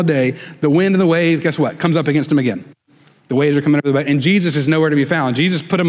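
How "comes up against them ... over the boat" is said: usual speaking voice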